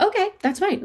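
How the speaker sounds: light, high, breezy okay; shrugging acceptance. Retold or imitated. Little girl shrugging off her mom with a high, easy okay. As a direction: toss it off like it doesn't matter. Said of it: "And I'd be like".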